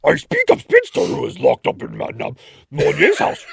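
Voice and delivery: loud, bad French accent turning monster; half-laughing, garbled French accent; Cookie Monster-like French accent. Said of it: "Cookie Monster nearly".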